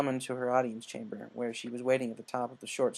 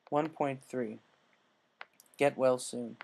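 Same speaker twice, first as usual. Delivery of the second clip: plain reading voice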